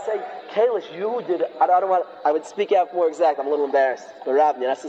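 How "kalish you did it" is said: ordinary talk, nothing marked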